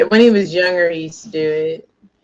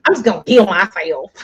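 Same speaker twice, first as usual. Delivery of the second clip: lightly mimicked country four-year-old; upset little boy, country accent; country little kid; 2 semitones higher, 4 dB louder than usual. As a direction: imitate a small boy's country accent. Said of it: "it, it's so country".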